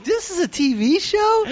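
high mock disbelief; incredulous. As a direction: squeak it out, stunned by bad TV. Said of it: "And I'm like".

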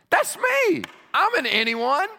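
very high, loud joyful cry; exuberant exclamation. Real, not acted. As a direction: crow it to the congregation. Preacher exclaims he is included.

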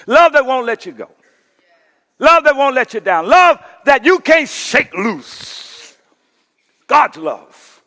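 full-force preaching climax; rising preaching. Acted. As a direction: drive it home with passion.

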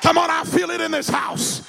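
high, slow shouted preaching; rousing the congregation to a shouted climax; preaching at full force. Acted. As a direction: drive it home to the church.

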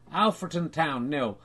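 normal talking voice

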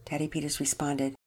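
ordinary delivery, nothing marked

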